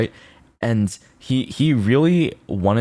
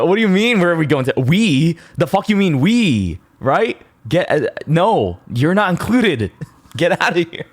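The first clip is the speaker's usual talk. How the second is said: loud, high, hammed-up indignation; mock outraged yell; 9 semitones higher, 4 dB louder than usual. To a pushy tout on the street, mock outraged yell. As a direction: yell it like a mock-offended friend. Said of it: "I'm like".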